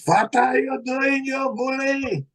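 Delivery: high, drawn-out fake Swedish accent; mock Swedish accent. Protesting to coppers who jumped him, mock Swedish accent. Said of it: "I put on my best Swedish accent".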